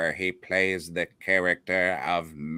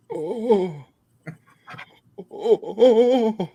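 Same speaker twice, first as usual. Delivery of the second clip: mock Greek accent; 13 semitones higher, 5 dB louder than usual. Shown as a demonstration. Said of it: "I can do a pretty good Greek accent".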